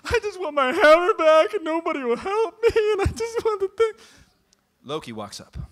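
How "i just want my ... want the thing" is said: put-on much higher, louder childish wail; hammed-up distraught god whining; mock distraught whine. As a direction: blubber like a toddler. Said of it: "Thor is distraught".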